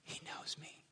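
awed whisper. Retold or imitated. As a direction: breathe it in wonder. Brother's awed confession.

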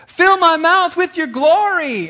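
high, loud, slow pleading cry; fervent prayer. Retold or imitated. Buddy Jarrett praying for gold teeth, high loud plea. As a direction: cry it out to heaven, over the top. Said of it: "my buddy Jarrett was like".

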